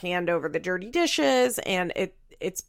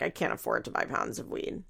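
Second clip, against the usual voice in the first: muttered aside; 8 semitones lower, 8 dB quieter than usual. Retold or imitated. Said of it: "Janelle's probably like".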